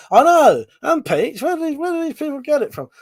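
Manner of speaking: high, fast, disbelieving exclaim; incredulous outburst. Real, not acted. Streamer reacting to a viewer's comment in chat, high incredulous exclaim. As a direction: throw the question up in disbelief.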